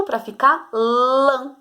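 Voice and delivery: "lã" drawn out slowly with a nasal a. Shown as a demonstration. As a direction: say "lã" through the nose, slowly. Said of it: "O tio".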